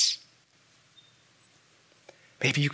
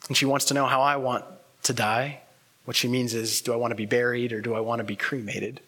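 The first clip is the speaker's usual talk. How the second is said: usual speaking voice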